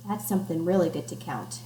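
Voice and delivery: plain reading voice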